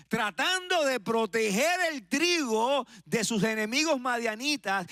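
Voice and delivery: high, driving pulpit proclamation; impassioned high-pitched sermon delivery; full-force preaching. Real, not acted. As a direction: preach it out with stretched, ringing phrase endings.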